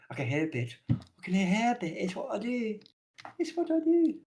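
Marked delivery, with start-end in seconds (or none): 0.9-1.9 higher take. Shown as a demonstration. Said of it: "repeated in a new voice". 1.9-2.8 pitched up, soft; higher voice. Shown as a demonstration. Joking around with friends, pitched up and soft. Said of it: "repeated higher". 3.4-4.1 squeaky high falsetto. Shown as a demonstration. Joking around with friends, a squeaky falsetto. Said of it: "repeated higher".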